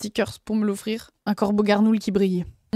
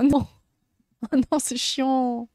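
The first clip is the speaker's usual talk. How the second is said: ordinary delivery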